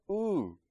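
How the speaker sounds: high startled ooh at her look; a squeak of alarm. Retold or imitated. Dad catching his wife's look, high startled ooh. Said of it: "I was like".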